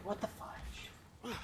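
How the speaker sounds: slow breathy whisper; whispered exclamation. Retold or imitated. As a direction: hush it out, slowly. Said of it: "I'm just like".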